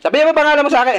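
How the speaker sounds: loud, high interrogating order; forceful demand. Real, not acted. Exorcist demanding the spirit's name, loud and forceful.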